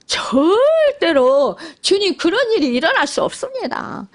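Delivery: a touch of indignant protest, slowed at the start; mildly imitated pleading objection; Peter protesting. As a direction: insist to the Lord it cannot happen. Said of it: "막 주님을 붙잡고 막 그냥 항변했다고".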